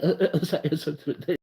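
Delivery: halting uh-uh-say stammer, lightly voiced; mock stammering Lamar Jackson. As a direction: stumble over every word like a shy quarterback. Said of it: "He just be like".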